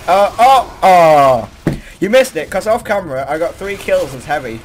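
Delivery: usual speaking voice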